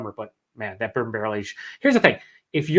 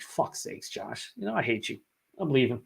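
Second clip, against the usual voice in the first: whispered, much softer mock annoyance; mock exasperation; 10 dB quieter than usual. Streamer mock-curses viewer Josh over his barley wine, whispered. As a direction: hiss it at him like a jealous friend.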